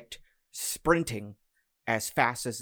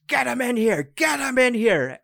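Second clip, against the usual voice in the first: very high, loud frantic yell; screaming; 11 semitones higher, 7 dB louder than usual. Acted. Man behind the iron door yells at guards, frantic scream. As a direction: scream the order over the chaos.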